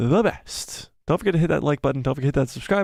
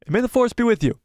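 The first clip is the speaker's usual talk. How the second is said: excited sign-off; 10 semitones higher than usual. Real, not acted. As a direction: sign off with big energy. Sign-off, high and loud.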